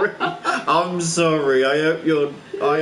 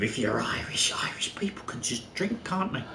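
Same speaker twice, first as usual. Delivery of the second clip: whispered aside; 5 semitones lower, 8 dB quieter than usual. Real, not acted. Whispered aside about Irish.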